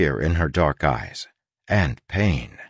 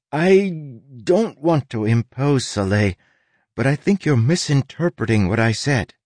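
higher, hesitant polite objection; reluctant Tress; 7 semitones higher than usual. Acted. Tress corrects Soleil after sighing, reluctant. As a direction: start hesitantly, then object gently.

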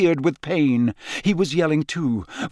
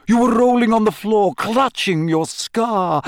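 high, dramatic insistence; insistent professor; 9 semitones higher, 5 dB louder than usual. Acted. Trelawney pressing Harry about his fit, theatrically insistent. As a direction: build the accusation with theatrical emphasis.

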